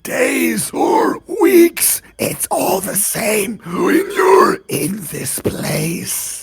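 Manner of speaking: slow, stretched creature voice. Acted. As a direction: draw every word out like a wailing creature. Game master voices creatures.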